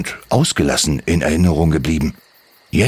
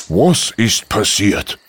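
a hint of a worried dad asking gently; concerned papa; 3 semitones higher, slower than usual. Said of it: "wollte nun ihr Papa wissen".

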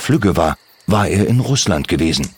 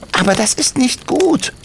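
high, patient little goblin voice; explaining kobold. Acted. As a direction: teach the children kindly. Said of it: "erklärte Timmy".

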